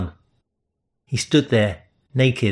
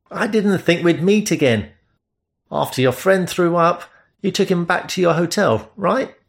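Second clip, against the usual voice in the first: higher, lightly voiced young man; easy, curious question ending up; a hint of a different man; stranger's voice; 7 semitones higher than usual.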